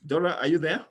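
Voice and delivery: usual speaking voice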